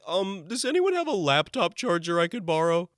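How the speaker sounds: high, loud, over-polite asking; timid request. Acted. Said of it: "None of that bullcrap way we all have to talk".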